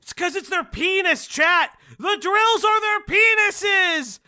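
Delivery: loud, rising mock outrage; joking shout. Real, not acted. Streamer yelling a crude joke to chat, loud and high.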